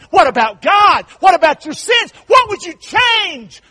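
shouted, high, rising preacher's call; full-force preaching. Acted. Preacher pressing the congregation about their last night, shouting. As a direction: hammer the questions home to the congregation.

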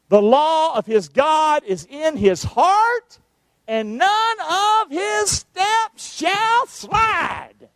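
slow reading that climbs into a high, drawn-out shout; scripture reading building to a shout. Acted. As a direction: preach the verse, rising to full cry. Reads verse, building to shout.

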